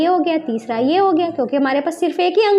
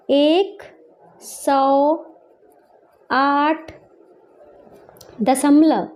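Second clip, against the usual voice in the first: separate, drawn-out words with pauses; slow dictation; much slower than usual. Shown as a demonstration. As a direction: say each word as you write it. Dictating while writing in words.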